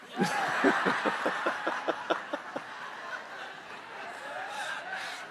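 long, rolling breathy laughter; long hearty laugh. Real, not acted. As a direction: keep laughing at the joke. Laughing at own joke.

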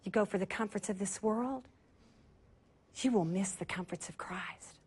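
very soft, low whispered preaching; hushed preaching. Acted. Preacher closing softly to the congregation, almost whispering. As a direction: draw them in, hushed and intimate.

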